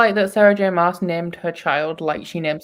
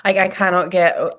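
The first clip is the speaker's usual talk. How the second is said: ordinary talk, nothing marked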